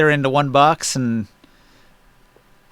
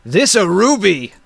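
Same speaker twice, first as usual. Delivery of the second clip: higher-pitched giant showing off a find; 5 semitones higher, 3 dB louder than usual. Acted. Giant picking through treasure, raised-pitch giant voice. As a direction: exclaim like a simple giant. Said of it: "the giant that was searching".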